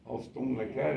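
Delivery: ordinary talk, nothing marked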